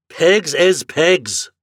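slow, loud, Irish brogue; firm declaration. Acted. Flannery insisting to Morehouse, firm brogue. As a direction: plant the words firmly. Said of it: "he declared firmly".

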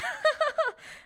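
high mock evil cackle; a mock villain laughing it off; sly villain laugh. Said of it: "Voldemort's like".

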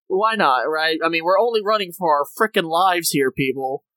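ordinary talk, nothing marked

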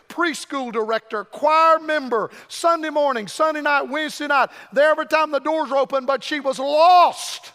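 loud, building preacher cadence; preaching at full force. Acted. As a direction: preach it with rising fire. Preaching builds on daughter's background.

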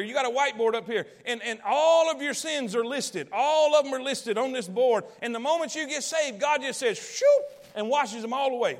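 loud, driving preacher cadence; full-force preaching. Acted. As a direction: preach it out to the whole room. Preaching to the congregation.

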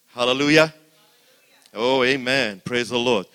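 shouting praise to the rafters; loud praise shout. Real, not acted. Preacher shouting praise to the congregation, loud. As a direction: call out hallelujah full-voiced.

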